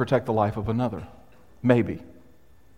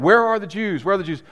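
raised-pitch imitation of soldiers' demands; half-voiced shout, pitched up; mock shouting; 6 semitones higher, 3 dB louder than usual. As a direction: mildly act out soldiers shouting in a search. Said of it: "shouting and screaming and".

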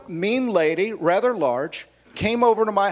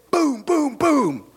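mock door pounding; 8 semitones higher, much slower than usual.

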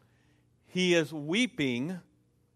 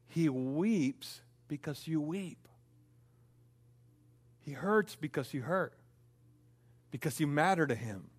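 tender pastoral; 6 dB quieter than usual. Acted. Pastor comforting the hurting congregation, soft and tender. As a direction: comfort each listener gently.

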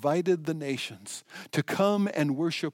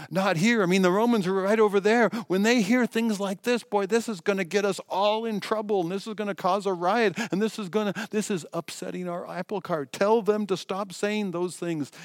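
higher, louder, fast alarmed pleading; panicky leaders; 7 semitones higher, 6 dB louder than usual. Acted. Chief priests begging Jesus to silence the children, panicky and loud. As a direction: sound frantic that the Romans will hear.

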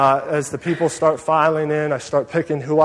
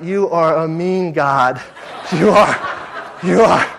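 mock outrage; 4 dB louder than usual. Passenger to God as the huge man takes seat beside him, comically aggrieved. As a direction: scold God in exaggerated dismay.

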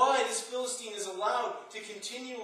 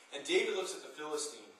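normal talking voice

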